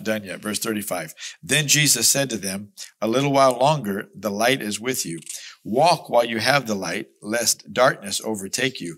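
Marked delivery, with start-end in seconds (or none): 1.4-9.0 loud, ringing scripture proclamation; booming pulpit reading; preaching at full force. Acted. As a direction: proclaim the verse to the whole church.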